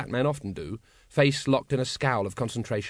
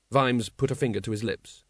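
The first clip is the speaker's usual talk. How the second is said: ordinary delivery, nothing marked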